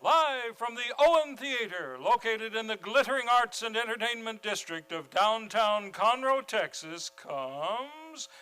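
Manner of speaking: big, ringing radio announcer opening; announcer intro. Acted. Host welcoming the radio audience, grand announcer voice. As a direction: open the show like a vintage radio host.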